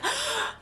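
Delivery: sharp high gasp, half laughing; mock sobbing gasp. Mimicking her own sob to her viewers, high gasp.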